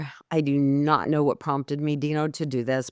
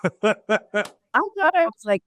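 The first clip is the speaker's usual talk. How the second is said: laughing disbelief; 5 semitones higher, much slower than usual. Real, not acted. Laughing disbelief retelling lunch shock.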